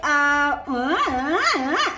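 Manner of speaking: short swooping "ah" sounds of relish; demonstrated delighted "ah". Shown as a demonstration. Coach models reaction sounds for followers, delighted. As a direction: let each ah swoop up and down. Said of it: "ah, macam tu".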